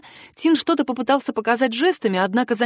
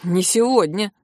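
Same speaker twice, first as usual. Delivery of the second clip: firm, even refusal; understated flat-out refusal; categorical; slower than usual. A narrator's reading. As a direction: rule it out firmly. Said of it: "категорично заявил Герард".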